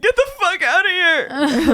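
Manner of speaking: slow, high, loud angry order; hammed-up drawn-out shout; mock furious yell. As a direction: play an enraged dad yelling in someone's face. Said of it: "this just makes him angrier".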